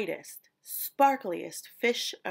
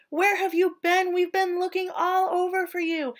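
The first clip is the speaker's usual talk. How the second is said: excited friends; 8 semitones higher, 6 dB louder than usual. A narrator's reading. Friends greeting Stanley.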